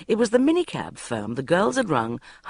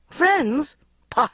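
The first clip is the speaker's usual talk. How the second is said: gleeful, high cry; cheerful tipsy shouting; happy shout; 6 semitones higher, 4 dB louder than usual. A narrator's reading. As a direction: crow it out with delight. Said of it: "I shouted happily".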